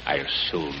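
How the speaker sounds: low, hushed, secretive and menacing; the hidden avenger speaking softly in the dark; the Shadow's hushed voice. Acted. Lamont becomes the Shadow, hushed.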